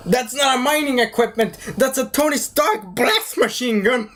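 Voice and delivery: high-pitched mock indignation; incredulous protest. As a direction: correct them in exasperated disbelief. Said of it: "and I'm like".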